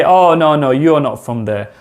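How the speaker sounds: normal talking voice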